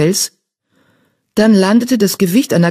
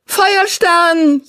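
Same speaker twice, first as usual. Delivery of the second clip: high yowl of a name across the camp; 14 semitones higher than usual. Acted. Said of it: "jaulte sie".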